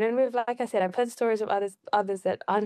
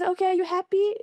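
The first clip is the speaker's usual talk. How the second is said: dad's gentle question; 9 semitones higher than usual. Retold or imitated. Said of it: "he's like".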